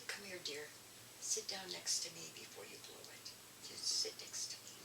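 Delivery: soft, hushed words easing into a whisper; calm her down quietly so nobody hears. Retold or imitated. Older woman hushing the angry speaker beside her, soft whisper.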